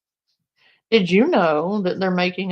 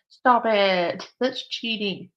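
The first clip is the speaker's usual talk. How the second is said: ordinary talk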